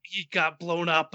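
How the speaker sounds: ordinary delivery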